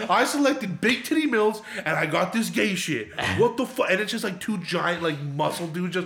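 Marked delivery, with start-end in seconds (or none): 0.1-3.9 higher, imitated indignant protest; fuming complaint. Friend who got the wrong video, fuming complaint. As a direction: act out a cheated customer's outrage. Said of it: "And so he's fuming".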